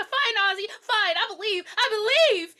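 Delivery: high, loud, fed-up shouting; exasperated giving in. Retold or imitated. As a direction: shout that you believe, half mocking. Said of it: "She's like".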